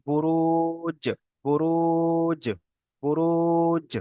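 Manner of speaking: বুরুজ with long level "ruu", then a bounced j; qalqalah on jim. Shown as a demonstration. Teacher modelling two sakins together, বুরুজ drawn out with a bouncing j. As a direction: draw out the u of বুরুজ and echo the final j. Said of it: "আর একটা জিমের মতো উচ্চারণ হচ্ছে".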